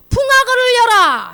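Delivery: slow, high, booming shout; festive command. Acted. Father calling the servants to start the feast, booming shout. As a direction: bellow it to the whole house.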